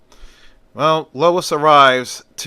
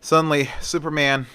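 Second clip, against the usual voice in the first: plain reading voice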